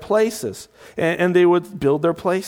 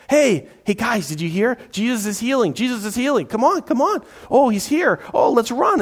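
a higher, excited rush of voices; eager crowd chatter; 6 semitones higher than usual. Retold or imitated. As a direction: call out like someone hurrying to see a healer.

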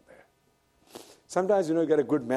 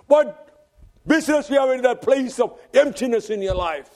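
raised, ringing preacher's voice; preaching at full force; 12 semitones higher, 6 dB louder than usual. Acted. Preacher retelling Elijah's flight to the congregation, preaching at full force. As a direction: proclaim it to the congregation.

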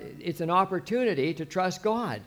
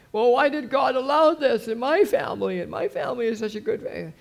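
aggrieved believer; 8 semitones higher, 4 dB louder than usual. Retold or imitated. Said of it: "I've seen this so many times".

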